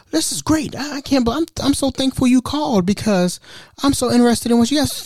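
high, overeager fake interest; fake enthusiastic. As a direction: gush with false enthusiasm. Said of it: "I'm like".

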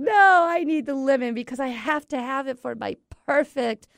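high, loud mock French accent; indignant French pastry chef, hammed up; pastry chef's accent. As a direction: protest loudly in a put-on French accent. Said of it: "we got the pastry chef saying".

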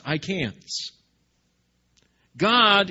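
usual speaking voice

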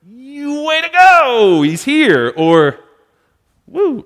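high, loud, hammed-up cheering; mock cheer. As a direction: cheer him on like a fan, hammed-up.

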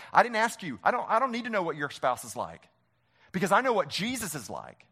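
usual speaking voice

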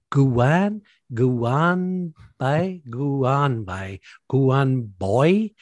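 put-on halting GUAN, then BAI, then BOY, stretched out; stretched questioning "Guan... Boy?"; unsure sounding-out. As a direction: sound out "Guan Bai" like a puzzled outsider reading it. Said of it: "trying to read it even".

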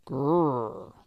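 loud rumbling growl; tiger growl. A narrator's reading. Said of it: "the tigers only answered".

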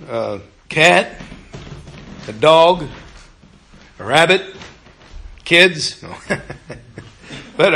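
ordinary delivery

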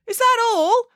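very high, questioning voice, rising at the end; senior journalist asking. A narrator's reading. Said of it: "asked a senior journalist".

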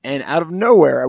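normal talking voice

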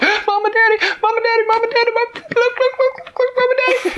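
very high, loud frantic cub; a cub calling his parents urgently; squeaky high look-look chatter; excited baby bear. Acted. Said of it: "Baby Bear said".

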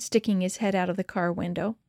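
usual speaking voice

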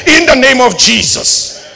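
loud, slow declaration; slow shouted blessing; preaching shout. Real, not acted. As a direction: proclaim it with full force. Preaching climax.

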